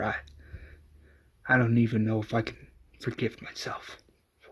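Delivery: soft, subdued, halting confession; hushed, understated grief with long pauses; tearful apology. A narrator's reading. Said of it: "in tears".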